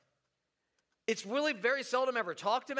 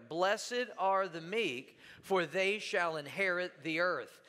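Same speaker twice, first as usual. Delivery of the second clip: slow recitation together; 4 semitones lower, slower than usual. Shown as a demonstration. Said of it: "Let's say it together".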